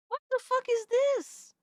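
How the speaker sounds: high-pitched shocked yelp; real outburst at a cursed video; shocked disbelief. Real, not acted. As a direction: squeal it in horrified disbelief.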